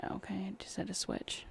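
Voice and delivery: hushed whispered aside; worried whisper to the stream about a glitch. Real, not acted. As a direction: whisper it under your breath.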